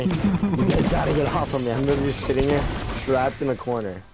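panicked gamer begging for help; panicking gamer. Real, not acted. Enemies attacking him.

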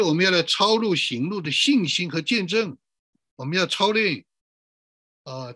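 usual speaking voice

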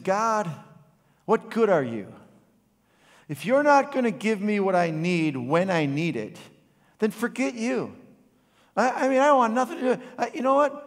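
put-on young father, broke, shouting angrily at God outside the trailer; angry shout at God. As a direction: yell your frustration up at heaven. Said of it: "in a pretty loud voice, I was angry".

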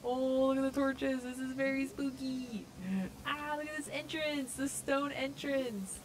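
high, loud gushing; hyped. Real, not acted. Gamer discovering torches and stone entrance, hyped. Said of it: "I'm getting so hyped".

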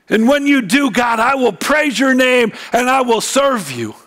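high, urgent pulpit cry; impassioned preaching. Acted. Preacher voicing Hannah's desperate prayer to God, impassioned. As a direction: pour it out in a high, pleading sermon voice.

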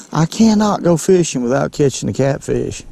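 ordinary talk

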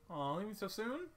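high, slow, drawn-out pleading; pouting plea. Acted. Asking a partner to stay a little longer, pouting plea. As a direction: wheedle playfully with a drawn-out pout.

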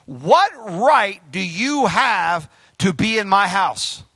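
each word drawn out slowly; slow, measured rebuke; slow, weighty translation. Shown as a demonstration. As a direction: deliver the paraphrase slowly, like a verdict. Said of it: "give you the translation".

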